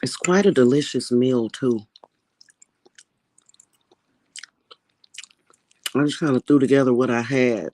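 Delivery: talking while chewing; chewing noisily. Real, not acted. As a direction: speak through a mouthful. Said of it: "I'm sorry, I'm eating".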